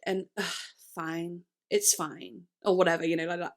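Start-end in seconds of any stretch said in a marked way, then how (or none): 0.4-2.5 slow, softer mock exasperation; imitated dismissive song attitude. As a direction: toss it off slowly with mimicked attitude. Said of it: "that attitude of it".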